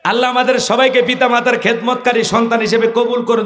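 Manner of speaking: ordinary talk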